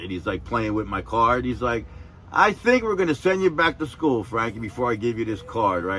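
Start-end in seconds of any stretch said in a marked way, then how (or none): 2.3-5.8 much higher, loud public telling-off; mock scolding boss. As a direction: roast him in front of everyone. Boss teasing threat.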